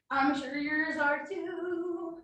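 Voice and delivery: ordinary delivery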